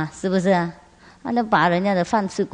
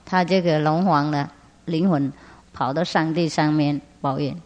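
ordinary talk

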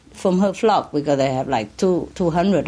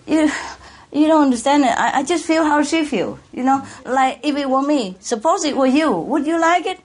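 raised high, pleading; impassioned plea; 7 semitones higher than usual. Real, not acted. To the listener about the suffering goat, impassioned plea. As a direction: make them feel the goat's pain.

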